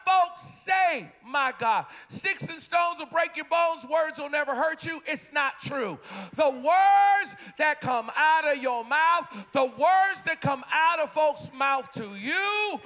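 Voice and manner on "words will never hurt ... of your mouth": normal talking voice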